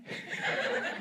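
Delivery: a quick unvoiced chuckle at his own joke; soft breathy laugh. Real, not acted. Preacher laughing softly at his own story. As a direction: let a small breathy laugh escape.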